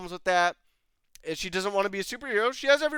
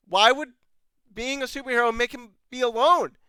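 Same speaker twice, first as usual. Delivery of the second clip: ordinary delivery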